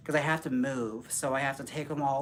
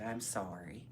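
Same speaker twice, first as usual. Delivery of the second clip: accidental Canadian "sorry"; low, soft, slightly Canadian apology; Canadian accent; 7 semitones lower, 12 dB quieter, slower than usual. Real, not acted. Apologising to Sponge, slightly Canadian accent. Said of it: "Oh, I sounded Canadian there".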